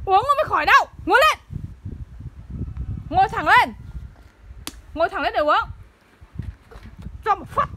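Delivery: shouting orders. Real, not acted. Mother ordering her sick child to sit up, shouting.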